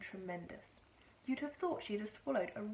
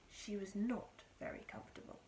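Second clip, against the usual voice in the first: plain reading voice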